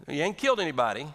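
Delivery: ordinary talk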